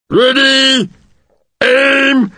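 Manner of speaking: high, loud yelled commands with a slip; hesitant yelled firing command; yelling. Acted. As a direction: shout the orders and blunder into fire. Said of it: "he yelled".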